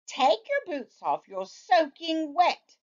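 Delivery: a hint of a patient mom; mildly voiced mother, calm. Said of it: "somewhat calmly".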